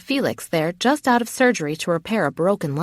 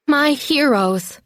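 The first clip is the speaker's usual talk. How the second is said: high, drawn-out relieved exclamation; a touch of tearful relief; weeping with relief; 7 semitones higher, much slower than usual. A narrator's reading. Woman greeting her rescuers, mild weeping relief. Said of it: "I weep with relief".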